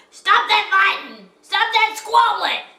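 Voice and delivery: loud, high scolding yell. A narrator's reading. Gertie Toad yelling inside her cottage, loud scolding. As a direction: yell at squabbling children. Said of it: "he heard her yelling inside".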